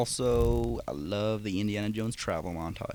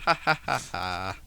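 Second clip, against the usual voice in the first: mock laugh; slower than usual. Mocking laugh at the line.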